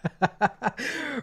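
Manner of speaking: high burst of laughter; cracking up; amused laughter. Real, not acted. Amused by Roxy's chat message.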